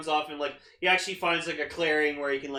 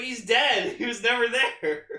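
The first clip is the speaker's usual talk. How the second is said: louder, laughing through the words; laughing retold reply; 6 semitones higher, 8 dB louder than usual. Answering him about the episode, louder with laughter. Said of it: "Yeah, like".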